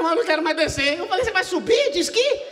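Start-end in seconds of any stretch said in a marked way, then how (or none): 0.0-1.0 high, loud mock panic of the wife; wife refusing to ski down, mock panicked; retold panic. Wife scared on ski slope. 1.2-2.4 high, loud laughing scoff; husband scoffing at scared wife on the slope; mocking retort.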